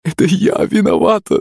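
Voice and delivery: high-pitched woman wailing; tearful wail. A narrator's reading. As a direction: wail a desperate confession. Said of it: "завыла Пышка". (с воем, причитая)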